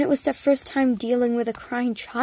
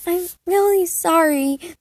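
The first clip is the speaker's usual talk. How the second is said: higher anxious apology; stressed apology; 6 semitones higher than usual. Acted. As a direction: plead anxiously. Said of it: "the frail girl stressed".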